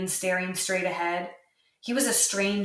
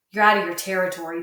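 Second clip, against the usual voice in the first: usual speaking voice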